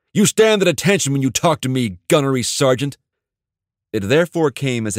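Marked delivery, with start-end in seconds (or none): 0.1-3.0 loud, higher order, held; commanding shout. Acted. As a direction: keep barking the order down at him. Officer dressing down the sergeant.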